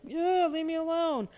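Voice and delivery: high-pitched mock exasperation; acted weary irritation; fed-up brush-off. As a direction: wave them off, acting tired of it. Acting out being fed up.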